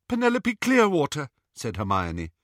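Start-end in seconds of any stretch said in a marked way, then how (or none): 0.1-1.3 high, frightened answer; terrified but convincing. A narrator's reading. Hermione giving captors false name, terrified. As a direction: give the lie high and scared. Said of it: "She sounded terrified, but convincing".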